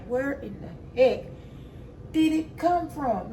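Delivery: high, drawn-out baffled question; acted bafflement. Woman wondering aloud where the bug came from, baffled. Acts out baffled search of floor.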